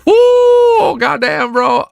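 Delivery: excited whoop. Real, not acted. Reader cheers at chapter length.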